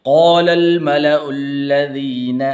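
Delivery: melodic chanted recitation; Quran recitation. Real, not acted. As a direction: chant each verse slowly with melody. Teacher recites Quran verses.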